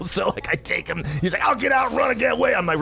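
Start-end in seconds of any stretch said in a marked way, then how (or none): 1.4-2.5 high-pitched mock threat; threatening brother. Brother threatening to bolt from the truck, high-pitched imitation.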